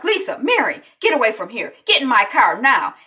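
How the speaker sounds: a loud, high order to get in the car; commanding shout. Retold or imitated. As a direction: bark it like a protective big sister. Anissa orders girls from dealers.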